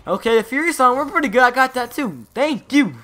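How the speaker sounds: high, loud burst of delight; thrilled. Real, not acted. Gamer finding he got the Fury song too, thrilled. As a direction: exclaim over a second lucky win. Celebrating another good drop.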